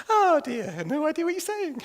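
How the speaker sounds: high, jolly put-on voice; clueless Englishman. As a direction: pretend to laugh along, understanding nothing. Acts clueless Englishman laughing along.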